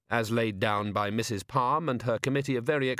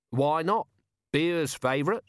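cocky boast; 5 semitones higher than usual. Acted. Nobby boasting.

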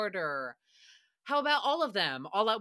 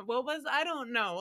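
normal talking voice

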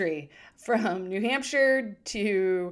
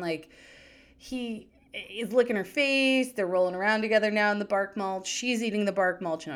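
ordinary delivery

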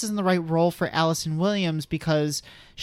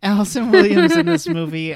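louder redo; 5 semitones higher, 7 dB louder than usual. Shown as a demonstration. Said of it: "Do it again".

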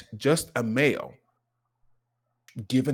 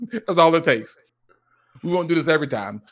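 ordinary delivery

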